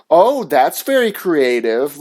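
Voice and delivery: slow, swooping mock praise; drawn-out "oh" dripping with sarcasm; sarcastic. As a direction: imitate a teacher's sarcastic compliment. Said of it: "all sarcastic and being a fucking asshole".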